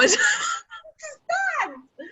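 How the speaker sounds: high, cracking burst of laughter; shrieking laugh. Real, not acted. Storyteller cracking up at his own retort, shrieking with laughter. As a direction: burst out in a high helpless cackle.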